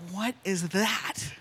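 slow, hushed wondering; retold puzzlement. Feeling a tug on her ear at the sink, retold hushed puzzlement. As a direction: draw the question out softly, as if puzzled.

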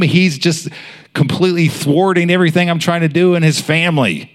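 high, frustrated villain complaint; exasperated enemy. Acted. The enemy grumbling that Josh keeps praying, exasperated. Voicing the enemy ranting about prayer.

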